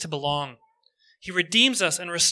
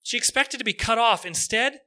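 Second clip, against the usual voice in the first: ordinary talk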